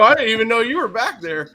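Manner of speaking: loud high protest of innocence; mock indignant yell. Man yelling innocence at a cop. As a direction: yell it like you're wronged.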